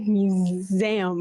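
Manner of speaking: "museum" imitated as myoo-ZAM; mocked pronunciation. Friend challenging the streamer, mocked pronunciation of "museum". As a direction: mimic the odd ZAM ending of "museum". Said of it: "who the fuck says it like".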